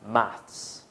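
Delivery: maths said the British way, with a clear final s; British pronunciation. Shown as a demonstration. British teacher modelling maths for students to repeat. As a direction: model maths as UK English speakers say it. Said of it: "say it with me".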